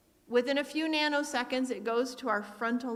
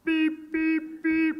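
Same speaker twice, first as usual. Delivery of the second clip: higher, louder beeping horn imitation; imitated car horn; 6 semitones higher, 6 dB louder, much slower than usual. Father honking for his wife, imitated horn beeps.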